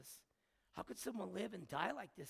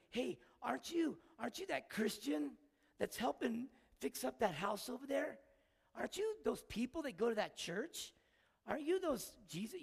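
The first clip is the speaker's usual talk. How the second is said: curious neighbors; 6 semitones higher, 5 dB louder than usual. Retold or imitated. Neighbors accosting the speaker.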